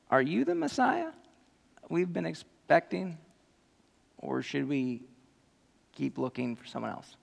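a nervous, shrinking question; timid question. Retold or imitated. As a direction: ask it sheepishly, voice shrinking. Timid question asked of Jesus.

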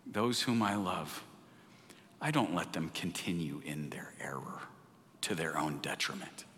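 hushed, intimate whisper from the pulpit. Acted. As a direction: lean in and breathe it like a secret. Jesus' tender words, whispered.

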